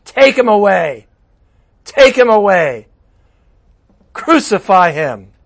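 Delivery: loud, slow mob yells; crowd shouting. Acted. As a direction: bellow it like an angry mob. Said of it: "They shouted".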